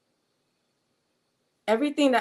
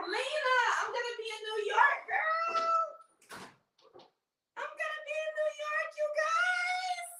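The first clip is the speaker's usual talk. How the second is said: very high-pitched excited squeal; excited screaming. Real, not acted. Telling viewer from New York about visit, excited squeal. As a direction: squeal the news out, thin and far above your normal pitch. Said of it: "I'm screaming".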